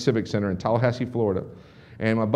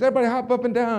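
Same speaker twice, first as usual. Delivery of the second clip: mock hype man; 10 semitones higher, faster than usual. Hype speaker rallying crowd.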